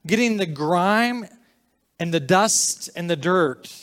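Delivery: loud, high-pitched preaching; worked-up sermon delivery with pauses between phrases; emphatic preaching. Real, not acted. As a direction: raise the voice and press each phrase home. Raised-voice preaching.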